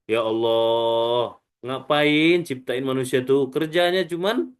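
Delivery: slow, drawn-out complaint to God; long-drawn questioning appeal; protesting angels. Acted. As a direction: drag out the words in protest. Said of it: "Apa langsung kata malaikat?".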